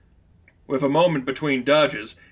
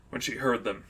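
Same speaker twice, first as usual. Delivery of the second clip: ordinary delivery, nothing marked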